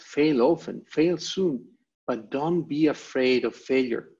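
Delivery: normal talking voice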